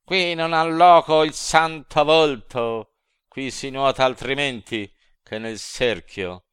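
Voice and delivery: high, loud taunting devil yell; demons shouting. A narrator's reading. Devils jeer at the sinner in the pitch, high loud taunting yell. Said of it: "i demon che del ponte avean coperchio gridar".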